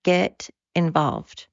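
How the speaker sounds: slow, drawn-out urging; slow, deliberate second call; slow emphatic repeat. Real, not acted. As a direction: lean on it slowly this time. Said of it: "repeated call to action".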